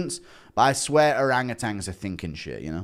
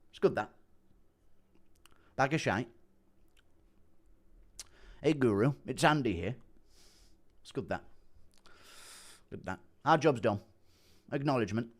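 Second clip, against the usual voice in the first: quiet, terse catchphrases in a mimicked voice; Andy Aspinall impression; 7 dB quieter than usual. Streamer practising fighter's sayings, softly impersonated. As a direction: murmur short approvals like the fighter. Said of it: "has heard my Andy Aspinall impression".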